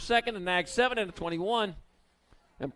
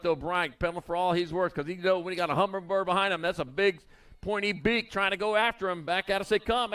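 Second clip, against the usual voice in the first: ordinary delivery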